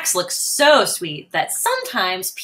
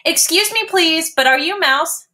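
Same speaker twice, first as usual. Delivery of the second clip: high, polite little kitten; 8 semitones higher, 3 dB louder than usual. Acted. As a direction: ask nicely like a small kitten. Max asks politely.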